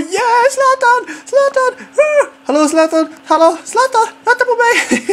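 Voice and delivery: high, loud, stretched yells after Zlatan; excited yelling. Retold or imitated. Fan chasing teammate Zlatan after the goal, yelling high and drawn out. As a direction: chase him down screaming his name.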